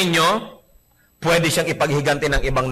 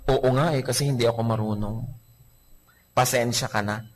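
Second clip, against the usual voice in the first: softer, lower humble answer; meek gentle reply; 5 semitones lower, 4 dB quieter than usual. Acted. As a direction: answer meekly to calm them. Gentle answer to angry person. (mahinahong sagot, mahina at mababa)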